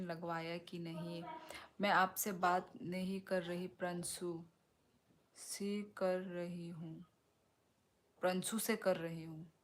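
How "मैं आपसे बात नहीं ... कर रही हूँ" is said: normal talking voice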